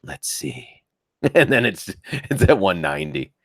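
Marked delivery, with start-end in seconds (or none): 0.0-0.7 slow hushed whisper; slow private whisper to himself. Real, not acted. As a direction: breathe it out, barely voiced. Slow whispered aside on the number.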